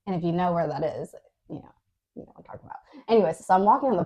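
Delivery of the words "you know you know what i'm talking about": hushed, knowing aside; knowing whisper. Real, not acted. Knowing aside about Sacramento.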